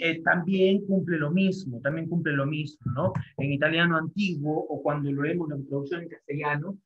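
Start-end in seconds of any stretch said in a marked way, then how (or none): 0.2-1.8 slow dictation. Shown as a demonstration. Teacher slowly dictating a point to the class. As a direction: dictate it slowly so students can write. Teacher repeats phrase for notes.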